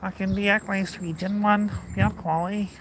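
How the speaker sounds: a hint of a Glasgow accent; Glaswegian accent. Vlogger showing off a Glaswegian accent to his friend. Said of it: "Just do an impression".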